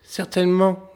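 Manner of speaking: bright high little man; sly little tailor. A narrator's reading. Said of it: "dit le petit tailleur".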